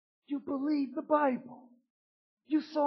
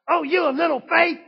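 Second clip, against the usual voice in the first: loud, high scolding shout; harsh rebuke; 6 semitones higher, 9 dB louder than usual. Retold or imitated. Jesus as wrongly imagined, shouting a harsh rebuke. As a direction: bark it like a preacher rebuking. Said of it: "I don't believe he said it like this".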